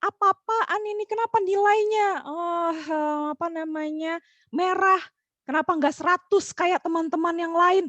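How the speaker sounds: hammed-up parent telling off the child; mock angry parent. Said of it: "marah terus". (meniru orang tua marah dengan suara tinggi)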